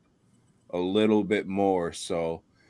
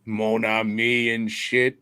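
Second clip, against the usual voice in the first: mock Cajun accent. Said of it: "is the Cajun Creole accent".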